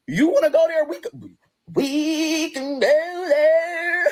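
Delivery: mocking the sister, drawn out and sing-song; imitating her sister. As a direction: throw her sister's threat back in a drawled, sing-song copy. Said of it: "Oh, I sound just like her".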